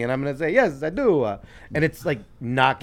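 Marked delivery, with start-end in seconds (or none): 0.5-1.2 high, louder fake-Italian reply; mock Italian accent. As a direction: answer back in a teasing Italian accent. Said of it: "start making fun of their accent".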